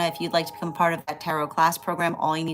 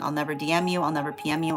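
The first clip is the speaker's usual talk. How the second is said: ordinary talk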